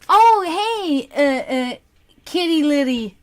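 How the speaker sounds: ordinary talk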